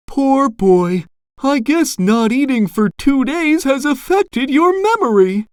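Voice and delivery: high-pitched, pitying mother; Missus Puff. A narrator's reading. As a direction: fuss over the boy in a high voice. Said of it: "Missus Puff said in surprise".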